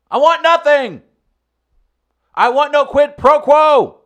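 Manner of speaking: high, loud declaration; mock presidential shout. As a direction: bellow it like a fired-up president. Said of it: "Let me get into character".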